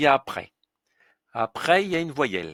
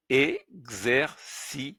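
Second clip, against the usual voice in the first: Exercice, very slow, each syllable apart; much slower than usual. Shown as a demonstration. Teacher slowly assembling the word Exercice. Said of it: "C I, si. C E, ce".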